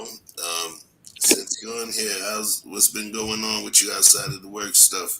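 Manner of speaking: ordinary delivery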